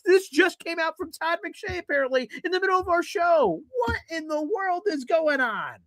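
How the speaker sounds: high-pitched, disbelieving rant, calling out Todd; exasperated rant. Real, not acted. Host ranting to his co-host about the mock draft flip, exasperated and high. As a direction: throw your hands up at the flip-flopping.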